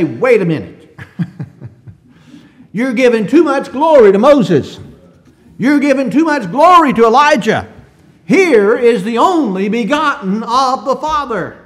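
loud, high booming rebuke with a laugh at the start; voice of God rebuking. Retold or imitated. Preacher voicing God from heaven correcting Peter, loud booming rebuke. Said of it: "as if to say".

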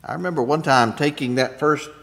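ordinary talk, nothing marked

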